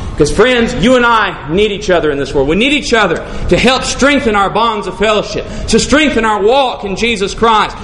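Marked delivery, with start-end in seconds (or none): none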